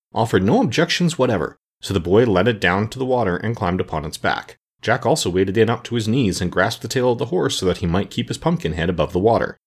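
ordinary delivery, nothing marked

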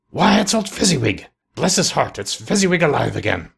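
high, delighted exclamation; great excitement. A narrator's reading. Scrooge seeing his old master alive, in great excitement. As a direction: start high and thrilled, settling as it ends. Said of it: "Scrooge cried in great excitement".

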